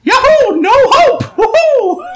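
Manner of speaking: very high, loud game-character whoops; cartoon plumber yelps; Mario. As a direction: squeal triumphant falsetto cheers. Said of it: "Oh, yeah, Mario".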